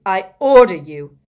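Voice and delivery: very loud command; fierce. A narrator's reading. Harry commands the silent elf, fierce. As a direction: hit the order hard and fiercely. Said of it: "said Harry fiercely".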